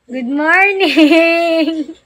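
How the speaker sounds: very slow, stretched good morning; long, lingering morning call; drawn-out greeting. Real, not acted. Greeting someone just waking.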